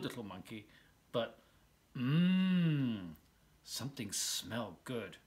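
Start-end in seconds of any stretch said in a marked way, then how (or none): 2.0-3.1 a long, dreamy mm at a delicious smell; savoring hum. A narrator's reading. George smells something good.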